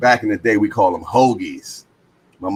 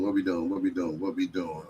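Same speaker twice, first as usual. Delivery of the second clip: each repeat softer, fading out; 3 semitones lower, 8 dB quieter than usual. Real, not acted. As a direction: let it trail off to a murmur. Repeats question, trailing off.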